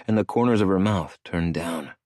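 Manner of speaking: plain reading voice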